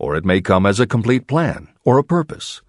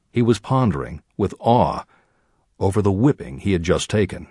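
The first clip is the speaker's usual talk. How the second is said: ordinary delivery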